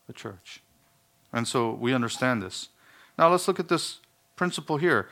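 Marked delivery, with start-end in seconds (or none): 0.1-0.6 the church repeated almost silently; soft echo. Shown as a demonstration. Preacher echoing his answer, very soft. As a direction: let it fall to a hush. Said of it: "Who does Christ share his intimacy with?".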